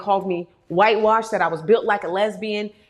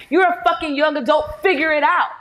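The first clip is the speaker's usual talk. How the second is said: louder and higher, brusque mock parent; mock harsh adult; 6 semitones higher, 5 dB louder than usual. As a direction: bark it at them like a fed-up parent. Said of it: "they were like".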